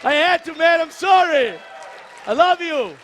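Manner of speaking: loud, high-pitched plea to be forgiven; shouted playful apology. Real, not acted. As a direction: call it out over the crowd's reaction. Playful apology called out after teasing.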